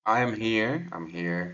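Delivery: usual speaking voice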